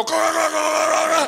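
guttural gargling demonstration; throaty repeated choking noise; imitated gagging. TV doctor demonstrates for the caller, gagging. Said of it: "put the dickhead in your throat and go".

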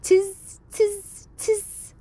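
high squeaky monkey "tiz"; monkey chatter. A narrator's reading. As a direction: chatter back like cheeky monkeys.

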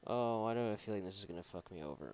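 ordinary talk, nothing marked

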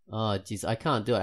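ordinary talk

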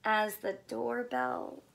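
lively picture book reading; story read to children. Acted. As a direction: read it like storytime for little ones. Reads picture book aloud.

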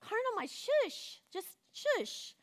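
mock-gentle shush to the carnal mind; hushing the flesh. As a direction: soothe and silence it playfully. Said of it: "And then I'm like".

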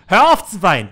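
loud barked order to stop crying; angry shout. Acted. Manager yelling at the crying girl to stop, loud and harsh. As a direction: yell the command at her.

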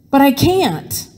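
plain reading voice

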